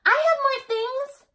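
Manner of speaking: very high, slow eager little voice; small person voice. A narrator's reading. Little one offering Elmore its things, high and eager. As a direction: offer proudly like a toddler. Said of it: "said the small person".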